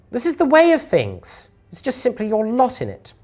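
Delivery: condescending lady, swooping up high; distorted female voice. Acted. Lady dismisses the glum Lorik, condescendingly pleased. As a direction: patronise an underling in a high, sugary lady's voice. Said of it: "uh, female, but it sounds distorted".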